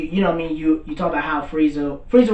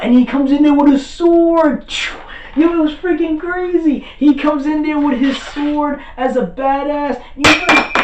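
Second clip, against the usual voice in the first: high, loud, thrilled retelling; near-yelling with fan excitement; hyped-up fan; 11 semitones higher, 5 dB louder than usual. Real, not acted. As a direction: gush over the coolest entrance ever. Excited retelling of the sword entrance.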